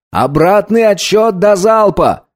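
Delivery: high, drawn-out announcement; commanding call. Acted. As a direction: call out the countdown urgently. Pilot announces countdown to salvo.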